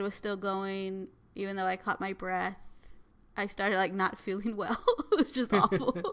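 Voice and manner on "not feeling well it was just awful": laughing through the words, louder. Real, not acted. Owner laughing about the awful chase. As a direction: giggle while admitting it was awful. Laughing at her own misery.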